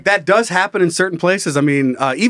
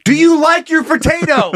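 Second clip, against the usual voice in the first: high, raised comic yell; mock shouting over noise; 10 semitones higher, 4 dB louder than usual. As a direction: shout to your date across a loud club. Shouting to date over rave.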